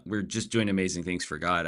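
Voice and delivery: ordinary delivery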